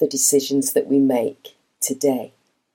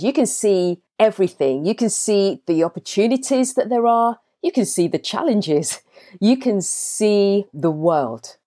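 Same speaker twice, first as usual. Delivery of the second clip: raised, uplifting coach's voice inviting the audience to dream; motivational speech; 4 semitones higher than usual. Acted. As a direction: lift them up and paint the vision. Motivational visualization address.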